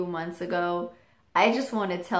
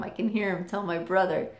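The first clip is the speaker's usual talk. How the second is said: ordinary talk, nothing marked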